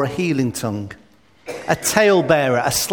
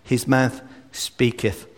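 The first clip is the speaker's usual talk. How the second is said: solemn archaic scripture reading, slowing and softening at the end; slow solemn scripture quote; King James reading; 2 semitones lower than usual. Shown as a demonstration. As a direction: read it like old scripture. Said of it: "The old King James Bible put it this way".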